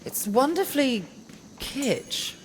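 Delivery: high-pitched, ambiguous praise; possibly teasing. Acted. Friend to a gushing diner fan, possibly teasing. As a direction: praise it so it might be mockery. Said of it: "Unsure if you're making fun of me".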